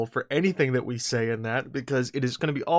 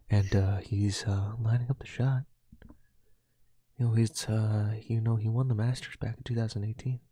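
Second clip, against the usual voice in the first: golf announcer; 4 semitones lower than usual. Acted. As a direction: murmur like a TV golf commentator. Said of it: "Just do my golf announcing the entire time".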